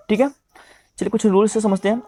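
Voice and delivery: usual speaking voice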